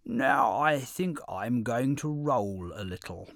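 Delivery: slow, sly camel threat; drawn-out teasing camel. A narrator's reading. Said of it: "the camel said".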